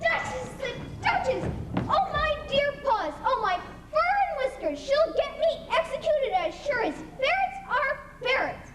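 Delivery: scurry and fret in a rising panic; panicked rabbit. Acted.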